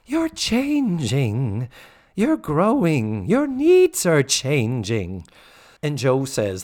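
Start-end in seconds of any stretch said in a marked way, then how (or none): 0.0-5.2 slow, swooping words of wisdom; hammed-up motherly life lesson; Mrs. Garrett impression. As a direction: draw out each line like a sage housemother. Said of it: "wisdom time".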